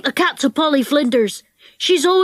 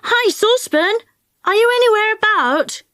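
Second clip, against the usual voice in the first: high-pitched hail; calling out; 10 semitones higher than usual. Acted. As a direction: call for someone out of sight, pitched up. Jo calls out for Saucepan.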